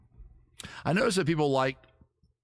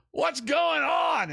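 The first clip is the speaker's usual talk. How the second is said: mock shout, much higher and at full volume; neighbor yelling; 12 semitones higher, slower than usual. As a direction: bellow it like a weird neighbor across the street. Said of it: "as loud as they could".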